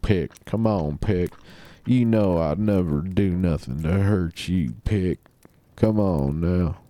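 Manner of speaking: slow, drawling Texas accent imitation. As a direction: lean into a thick Texas drawl, pleading. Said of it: "he's got a thick Texas accent".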